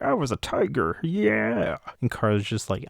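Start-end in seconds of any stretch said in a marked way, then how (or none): 0.0-1.8 mock freak-out, high-pitched boast; Happy. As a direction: imitate an overexcited character showing off. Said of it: "Happy is just freaking out".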